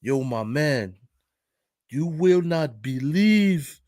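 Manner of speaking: put-on slow, drawn-out African-accented speech. Parking lot guy hailing his buddy, African accent. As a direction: imitate an African man's English, stretching the words. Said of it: "he got the African accent".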